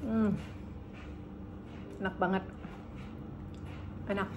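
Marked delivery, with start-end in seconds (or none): none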